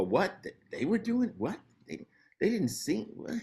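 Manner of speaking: high-pitched, stammering astonishment; mock disbelief. People hearing of a criminal's bust, acting stunned. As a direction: imitate baffled bystanders, voice leaping up on the questions. Imitating people shocked by arrests.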